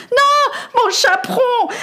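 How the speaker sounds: high, slow, tearful correction; a lady bursting back into tears; weeping lady. A narrator's reading. Lady corrects the boy about the goat, high and weeping. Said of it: "se remit à pleurer de plus belle".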